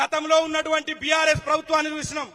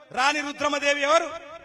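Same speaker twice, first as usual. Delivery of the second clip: ordinary talk, nothing marked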